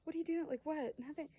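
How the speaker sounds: imitated defensive fluster; defensive and awkward. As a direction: brush it off too quickly, re-enacting awkwardness. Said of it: "got all defensive and awkward".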